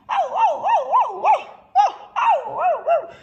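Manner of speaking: very high loud frightened cries; cry for help. Acted. Dog fallen through the cave cries out, frightened. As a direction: call for help from the hole. Noise of dog heard again.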